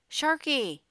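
high, loud call of a name; yell. A narrator's reading. Narrator as Jake yelling for Sharky outside the boxcar, yell. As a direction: yell out for a missing friend. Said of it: "I yelled".

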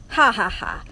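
loud, spoken-out ha-ha-ha; mock villain laugh. A narrator's reading. Rat watching the standoff, acted laughing. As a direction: laugh deliberately from the sidelines. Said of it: "Botticelli laughed from the sidelines".

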